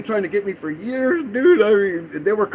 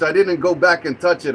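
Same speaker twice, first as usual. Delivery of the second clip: ordinary delivery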